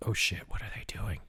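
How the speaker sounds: hushed, quick, scared whisper. Retold or imitated. Kids hiding from a stopped car, whispering in panic. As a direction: whisper it fast so nobody hears.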